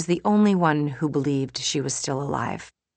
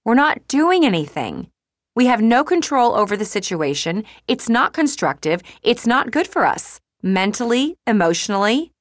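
higher, louder, insistent protest; exasperated friend; 7 semitones higher, 5 dB louder than usual. Acted. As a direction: press each point at a frustrated friend.

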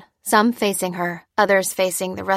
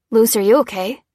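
worried; 3 semitones higher, 6 dB louder than usual. A narrator's reading. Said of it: "said Dan quickly".

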